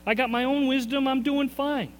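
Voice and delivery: high, smug brush-off; mock self-assured. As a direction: play the cocky know-it-all. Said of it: "you think you got it".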